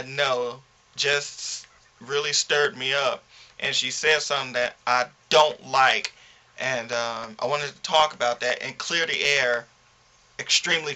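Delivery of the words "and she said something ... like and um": ordinary talk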